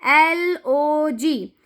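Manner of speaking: very slow, letter by letter; slow teacher spelling-out. Shown as a demonstration. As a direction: spell each letter apart for a child. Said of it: "ऐसे दूसरा word देखिए".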